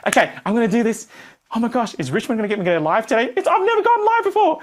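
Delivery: fast, rising panic about going live; a student psyching up then panicking; nervous excitement. Retold or imitated. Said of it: "You're like".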